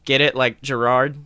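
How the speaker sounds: ordinary talk, nothing marked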